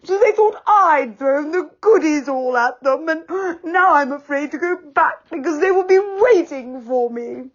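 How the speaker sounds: high, sobbing, broken-up lament; weeping. A narrator's reading. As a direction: cry through the words. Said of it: "wept Moonface".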